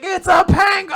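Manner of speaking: very high, loud imitated glee; giddy mock squeal, full volume; overjoyed shout. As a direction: yell it in hammed-up delight. Imitating Denji's delight at penguin.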